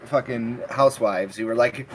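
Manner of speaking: ordinary talk, nothing marked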